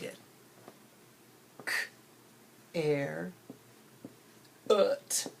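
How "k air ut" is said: K, air, ut as separate sounds with long gaps; isolated phonetic pieces K, air, ut; sounded out. Shown as a demonstration. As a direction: break it into K, air, ut, one sound at a time. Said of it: "wrote it how it sounded".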